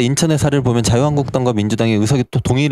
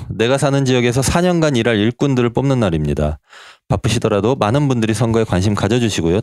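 ordinary talk

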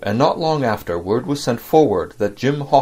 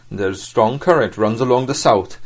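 ordinary delivery

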